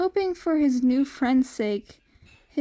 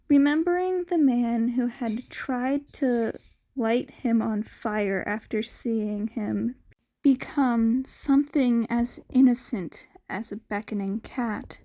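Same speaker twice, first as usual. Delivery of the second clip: ordinary delivery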